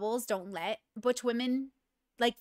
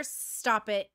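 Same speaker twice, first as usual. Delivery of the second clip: normal talking voice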